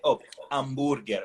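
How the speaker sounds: put-on Italian accent, hamburger as am-boor-ger with no h. Host mimics Sicilian relatives in an Italian accent. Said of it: "that's how they say it".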